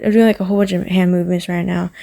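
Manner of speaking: quick, rushed and jittery; talking fast. Real, not acted. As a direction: hurry through the words. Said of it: "I'm talking very fast".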